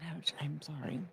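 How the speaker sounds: hushed whispered apology. Real, not acted. Streamer whispers a sheepish apology to chat. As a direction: whisper it like a guilty confession. Aside after UK joke.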